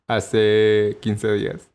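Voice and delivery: drawn-out, deliberate insisting; playful stubborn correction, very slow; slow insistence. Acted. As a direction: stretch each word out stubbornly. Insisting on the date, teasing.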